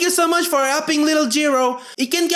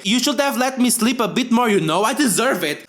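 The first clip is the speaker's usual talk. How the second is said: ordinary delivery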